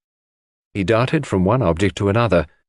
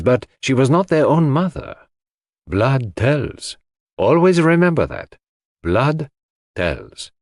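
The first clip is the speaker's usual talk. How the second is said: put-on higher, accented detective voice, emphatic; Poirot, grave; 5 semitones higher than usual. A narrator's reading. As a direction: instruct a friend gravely, leaning on key words.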